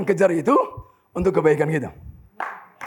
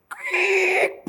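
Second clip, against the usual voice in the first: high, drawn-out car scrape noise; imitated scraping screech. Preacher imitates a motorbike scraping his new car, high screech.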